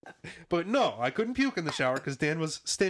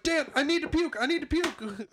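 high-pitched re-enacted desperate plea; urgent calling out; 13 semitones higher than usual. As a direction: beg through a locked door, voice way up. Repeats plea at locked door.

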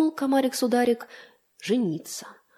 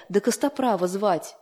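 usual speaking voice

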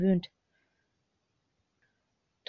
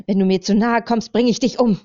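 louder and higher, shouted threat in panic; desperate threat; 3 semitones higher, 7 dB louder than usual. A narrator's reading. Fatima shouts at the man outside her door, desperate and loud. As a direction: scream the threat at him to keep him away.

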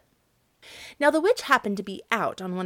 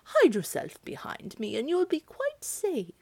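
lightly voiced cow, comforting; mild cow voice, reassuring; kindly cow; 6 semitones higher than usual. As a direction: reassure the girl as a gentle cow. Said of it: "answered the cow".

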